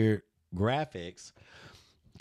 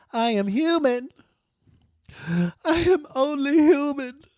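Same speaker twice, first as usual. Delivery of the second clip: mock dramatic plea; 6 dB louder than usual.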